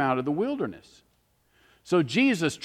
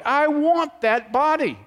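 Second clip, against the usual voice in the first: eager longing; 10 semitones higher, 4 dB louder, slower than usual. Real, not acted. Preacher longing for resurrection body.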